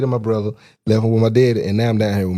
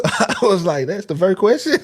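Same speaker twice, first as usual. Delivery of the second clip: words broken by real laughter; can't keep a straight face at this; laughing while telling; 9 semitones higher than usual. Real, not acted.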